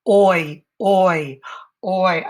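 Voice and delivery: repeated "oi" said firm and clear; sounding a diphthong. Shown as a demonstration. Teacher sounding out the oi diphthong. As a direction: stretch the oi in noisy. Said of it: "I know that diphthong".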